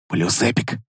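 low, hushed rising эпик; excited. Acted. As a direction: savour the word эпик quietly, rising at the end. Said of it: "с азартом повторил тот".

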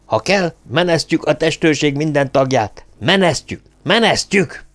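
high, rising agitation; near-hysterical. A narrator's reading. Hírek threatening to sack the guards, near-hysterical. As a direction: repeat it in mounting panic, pitch climbing. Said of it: "Már-már hisztérikusan ismételgette a szót".

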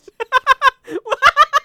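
shrieking burst of laughter; loud high laugh. Real, not acted. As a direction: let out a high cackle. Laugh at the summary.

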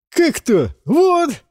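high, loud delighted call-out; excited teasing call. Acted. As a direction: show off to a friend. Жуан указывает на Варю. (восторженный возглас)

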